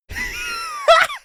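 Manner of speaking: high squealing laugh shout; shrieking laugh. Real, not acted. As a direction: let a squeal of laughter burst out. Bursts out over snails.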